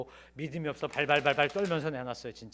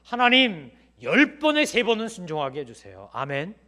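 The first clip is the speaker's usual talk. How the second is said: loud demo prayer; 3 semitones higher, 9 dB louder than usual. Shown as a demonstration. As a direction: cry it out as a model prayer. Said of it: "어떻게 해야 된다고요?".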